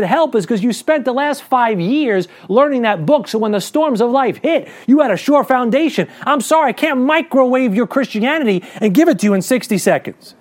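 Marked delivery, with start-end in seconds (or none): none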